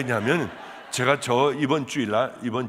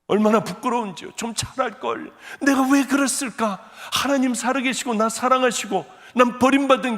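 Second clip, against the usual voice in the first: higher and louder impassioned confession; raised, fervent preacher's voice; fervent preaching; 7 semitones higher, 5 dB louder than usual. Real, not acted. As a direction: pour out the shame to the congregation. Preaching at full force.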